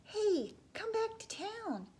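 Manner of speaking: high, loud joyful call; cheering crowd. Acted. Townspeople cheering the boy and his father, high loud joyful call. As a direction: call out in celebration.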